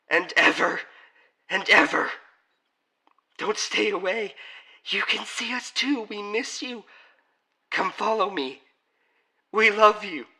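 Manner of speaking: high, loud ghostly pleading; insistent family voices. Acted. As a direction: call him back, high and insistent. Family's pleas grow more insistent.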